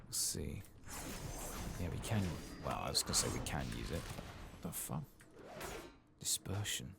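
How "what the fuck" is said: ordinary talk, nothing marked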